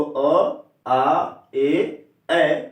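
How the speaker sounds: slow, deliberate listing of अ, आ, ए, ऐ; teacher drilling vowel sounds. Shown as a demonstration. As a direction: spell out each vowel sound slowly. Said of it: "ए मिलेगा अगर आपको".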